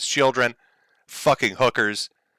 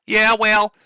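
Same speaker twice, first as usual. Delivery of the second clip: Ethan voice; 10 semitones higher, 5 dB louder than usual. Retold or imitated. Streamer reading Ethan's insult, high loud mock voice. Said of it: "From Ethan Ralph".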